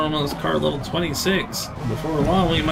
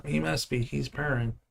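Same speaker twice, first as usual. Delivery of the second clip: normal talking voice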